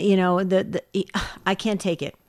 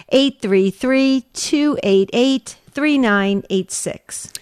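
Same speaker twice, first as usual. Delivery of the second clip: numbers read out slowly, one by one; slow phone number; 3 semitones higher, slower than usual. Shown as a demonstration. Host slowly gives listeners the call-in number. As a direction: spell out "eight three three" slowly so callers can dial.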